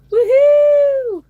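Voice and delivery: put-on loud, high woohoo of a party girl; party whoop. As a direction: whoop like it's your twenties again. Cheering about party days.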